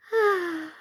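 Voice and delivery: high, delighted ah; rapturous sigh. A narrator's reading. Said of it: "said Birdie rapturously".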